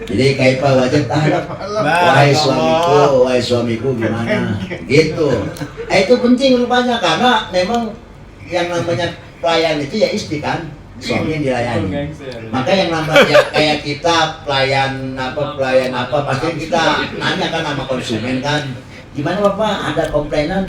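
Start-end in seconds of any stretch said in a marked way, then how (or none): 1.8-4.5 mock tender wife. Preacher voices wife addressing husband. 19.2-20.7 higher, polite waiter asking customer. Retold or imitated. Waiter asks a customer about complaints, higher polite voice.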